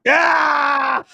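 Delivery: loud, high cheer; celebratory yell. Real, not acted. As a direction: shout out in joy. Celebrating a moment in the match.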